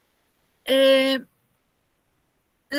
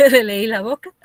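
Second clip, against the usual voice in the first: laughing; 8 dB louder than usual. Real, not acted. Laughs at own joke.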